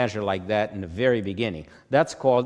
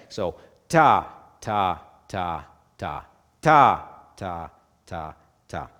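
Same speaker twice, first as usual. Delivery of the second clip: first ta punched loud, the rest light; accent on the first beat; 3 semitones lower, 5 dB louder, slower than usual. Shown as a demonstration. Teacher counting four four aloud, accenting beat one. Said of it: "usually the first beat gets the loudest of all four".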